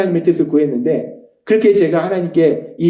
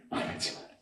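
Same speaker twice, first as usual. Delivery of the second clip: barely voiced whispered command; hushed divine reply; Holy Spirit's voice; 21 dB quieter than usual. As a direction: whisper it as the inner voice. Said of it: "그럼 성령님이 또 말씀하세요".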